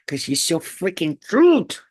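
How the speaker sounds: usual speaking voice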